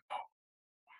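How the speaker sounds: breathless whispered wonder; barely voiced, taken aback by the sight; hushed gasp of amazement at the temple; awed whisper. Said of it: "you're like".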